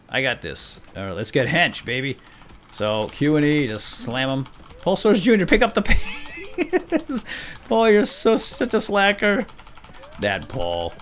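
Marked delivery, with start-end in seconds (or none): 4.8-9.4 high teasing with laughter; mock him between giggles; laughing tease. Real, not acted. Player teasing the slacking inmate, laughing.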